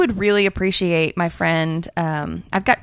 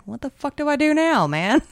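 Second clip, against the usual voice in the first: bewildered cat; 7 semitones higher than usual. Retold or imitated. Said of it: "Livy just sat on the bed like".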